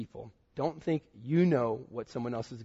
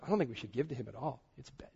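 quick, low, hushed mock gossip; trashing him; 3 semitones lower, 9 dB quieter, faster than usual. Young man trashing a friend person to person, hushed gossip. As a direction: mimic passing on gossip under your breath. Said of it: "just started just trashing him".

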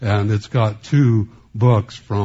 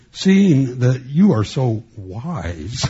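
slow, drawn-out mock admiration, pitched up; sarcastic; 3 semitones higher, 4 dB louder, much slower than usual. Preacher voicing Paul mocking the proud church, heavily sarcastic. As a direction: lay the sarcasm on slowly, stretching the praise. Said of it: "He's kind of being sarcastic here".

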